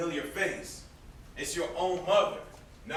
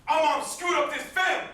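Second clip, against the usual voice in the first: higher and louder, spitting out the blame; bitter raised accusation; angry outburst; 9 semitones higher, 7 dB louder than usual. Acted. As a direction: hurl it at his brother. Brother blames mother during fight.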